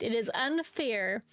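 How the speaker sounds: usual speaking voice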